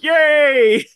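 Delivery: very high, loud puppet squeal of joy; imitated puppet cheer. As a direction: burst out in a tiny creature's hooray.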